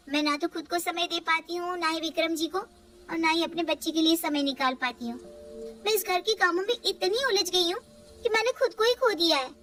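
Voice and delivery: weary daughter-in-law. Acted. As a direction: explain you have lost yourself in housework.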